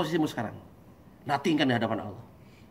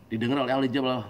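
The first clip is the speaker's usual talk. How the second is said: ordinary delivery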